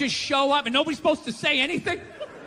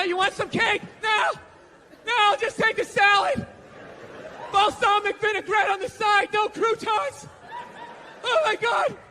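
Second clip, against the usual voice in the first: frantic dieter rant; 6 semitones higher than usual. Retold or imitated. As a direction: shriek like a starving dieter. Acting a miserable dieter.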